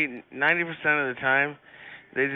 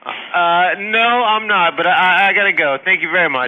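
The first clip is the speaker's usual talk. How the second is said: loud, higher, hurrying to hang up; flustered loud; 7 semitones higher, 10 dB louder than usual. Real, not acted. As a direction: nervously cut the call short. Flustered, hurrying off lewd call.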